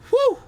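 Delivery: loud, high exhale of pain; sharp high whew. Real, not acted. Streamer groaning about sore nuts, loud high whew.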